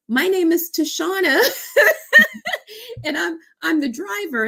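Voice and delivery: very high, tearful laugh; real laughter through tears; laughing and crying. Real, not acted. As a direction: confess through emotion. Teller laughs and cries revealing.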